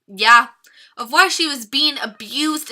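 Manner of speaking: usual speaking voice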